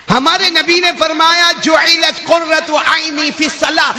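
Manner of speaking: high, impassioned sermon voice; fervent preaching. Real, not acted. Preacher urging the congregation to pray, impassioned.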